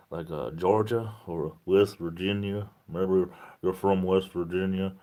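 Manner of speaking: a light, slow Southern drawl on West Virginia; put-on Southern accent. Teasing a viewer on the road down South, mild Southern accent. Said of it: "I'm just trying to get my accents down right".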